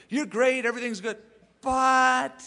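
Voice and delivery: high, loud praise landing on a long, held "but". Retold or imitated. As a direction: build up the praise, then hang loudly on "but". Voicing Jesus's praise before the rebuke.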